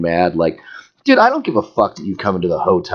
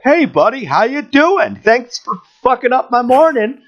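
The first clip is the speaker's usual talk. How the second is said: high-pitched fake-friendly greeting; mock-friendly sarcasm; 14 semitones higher than usual. Driver imagining greeting the cop who pulled him over, mock-friendly. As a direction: sarcastically chirp it, far above your normal pitch.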